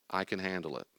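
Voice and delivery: soft, calm self-reassurance; calm self-talk. Shown as a demonstration. Speaker models self-talk for anxious people, soft and calm. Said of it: "what they should say to themselves".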